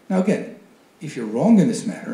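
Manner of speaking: ordinary talk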